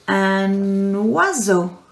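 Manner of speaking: "Un oiseau" with a clear wa sound, slow; slow French word. Shown as a demonstration. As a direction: stretch "oiseau" so the wa is heard. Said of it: "Repeat after me".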